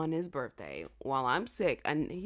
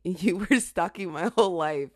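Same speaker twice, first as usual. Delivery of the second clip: laughing; 10 dB louder than usual. Real, not acted. Laughing while teasing.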